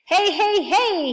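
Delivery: loud, high excited hail; yelled. A narrator's reading. Saucepan man spotting his friend on the ladder, yelling excitedly. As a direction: yell to a friend below. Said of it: "he yelled".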